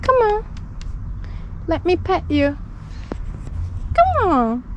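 high, sing-song call to a cat; high cooing calls to a shy street cat; coaxing a cat. Real, not acted. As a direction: coax the kitty over sweetly.

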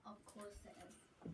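unvoiced whisper. Real, not acted. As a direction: whisper it aside. Whispered aside.

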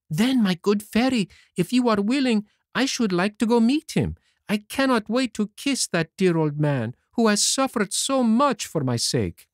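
put-on boyish Pinocchio voice. A narrator's reading. To the fairy, Pinocchio speaks in a high boyish voice.

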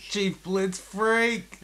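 high, very slow put-on call; hyping up the podcast sign-off; hammed sign-off voice. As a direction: drawl the nickname out.

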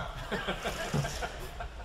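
a quiet, airy chuckle at himself; soft breathy laugh. Real, not acted. Pastor laughing at his own marriage story, soft breathy laugh.

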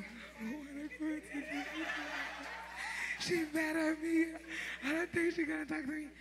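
high, whiny little voice; whiny childish self. Retold or imitated. Retelling her sulk to Apostle, a high whiny voice. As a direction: whimper like a sulking child. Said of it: "And this me".

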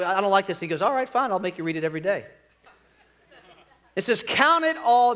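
usual speaking voice

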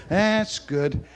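ordinary talk

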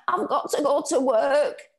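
wailing. A narrator's reading. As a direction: cry out from the fall. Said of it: "she kept on wailing".

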